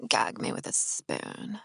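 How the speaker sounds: low, soft, slow creaky fry; vocal fry. Shown as a demonstration. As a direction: sink it deep into fry, slowly. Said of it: "why I can totally do it".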